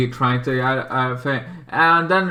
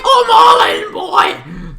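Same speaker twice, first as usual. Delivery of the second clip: much higher, louder shout of hype; excited shout; 7 dB louder, slower than usual. Retold or imitated. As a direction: yell it like you're totally sold. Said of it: "I'm like".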